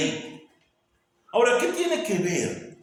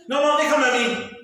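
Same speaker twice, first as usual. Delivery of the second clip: higher, louder boastful insistence; proud self-reliant person; 9 semitones higher, 6 dB louder than usual. Pretend. Preacher mocking people who want to save themselves, boastful insistence. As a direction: push God away like a proud child.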